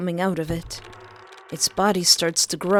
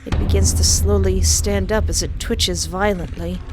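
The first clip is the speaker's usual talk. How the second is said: usual speaking voice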